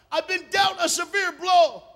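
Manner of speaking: high shouted lament, slow and heavy; anguished outcry. Acted. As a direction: hurl the pain out loud, slowly. Acts out Ruth's bitter cry.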